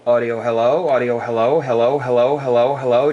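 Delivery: steady test count over the air; radio mic check. Real, not acted. Ham operator testing his amp, radio check calls.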